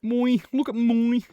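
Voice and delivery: high, slow hammed-up "moi"; mock diva. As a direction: camp diva showing herself off.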